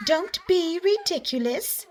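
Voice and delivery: high, slow, laughing birdsong voice; giggling canaries. A narrator's reading. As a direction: twitter it with a giggle. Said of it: "sang the canaries".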